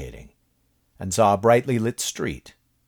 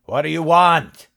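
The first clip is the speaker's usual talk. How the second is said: shouted, higher and louder; yelled; 10 semitones higher, 8 dB louder than usual. A narrator's reading. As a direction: yell it out at the creature. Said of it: "slightly louder, he yelled".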